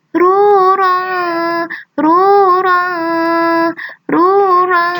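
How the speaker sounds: rolled "Rura" for the class; stressed rura. Shown as a demonstration. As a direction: hit each "Rura" hard with a trilled r. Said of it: "Itu huruf ra".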